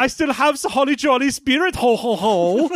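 high, jolly German Santa; hammy German accent with a Santa laugh. Acted. German Santa keeping up holiday spirit, jolly German accent. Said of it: "got a thick German accent".

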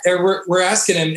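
usual speaking voice

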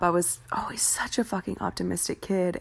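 ordinary talk

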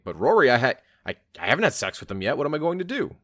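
panicked man blurting in bursts, pitch jumping; hammed-up panic, stumbling over the words; mock freak-out. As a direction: act out a flustered guy, loud start, rising to a high plea. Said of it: "all men are freaking out about".

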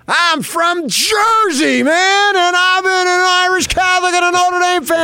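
put-on loud, high Jersey accent, slowly; thick Jersey accent. Father holding court with strangers in the press box, loud thick Jersey accent. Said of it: "He's got the thick Jersey accent".

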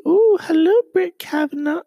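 high, loud sing-song hello; mock surprised hello; mock delighted greeting. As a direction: greet him with fake delight.

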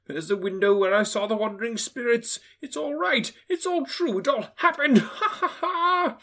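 high, rising to a laugh; old miser ending in a glad laugh; delighted Scrooge. Acted. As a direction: relish every memory then laugh.

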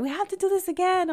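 high, fast enthusiasm; imitating eager friends. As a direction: gush like friends after a great night. Acted quote of enthusiastic friends.

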